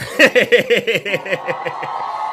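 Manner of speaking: loud, high cackling laugh; real cackle; big laugh. Real, not acted. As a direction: burst out laughing. Laughing at his own joke.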